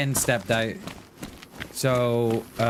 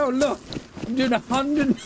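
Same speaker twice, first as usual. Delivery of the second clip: mock quote; 9 semitones higher than usual. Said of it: "that's exactly what I said".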